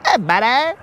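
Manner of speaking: high put-on buddy voice; Colin. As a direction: caricature a friend's high-pitched greeting. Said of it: "Colin sounds like this".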